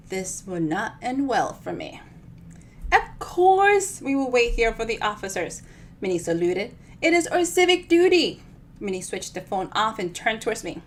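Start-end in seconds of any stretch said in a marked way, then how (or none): 2.9-8.4 higher, louder proud chirp; dutiful chirpy old lady. Acted. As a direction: play up the civic duty with relish.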